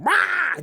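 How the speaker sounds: short high yelled "Rah!"; mock scream. Older brother screaming in the car about his little brother. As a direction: belt out a child's scream. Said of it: "starts this blood curdling scream".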